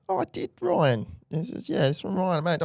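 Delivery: high, squeaky mock innocence; mock high-voiced excuse. Mate teasing Ryan, squeaky high-pitched excuse. Joking reply about the boat.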